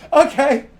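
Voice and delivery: high, loud laughing retreat; flustered exit. Acted. Player hurriedly leaves the room, flustered. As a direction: back out embarrassed, laughing. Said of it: "You're like".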